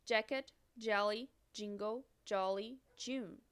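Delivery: each English word said clearly with pauses; teacher reading a vocabulary list in English; English model words. Shown as a demonstration. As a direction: model the English pronunciation for repeating. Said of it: "O J".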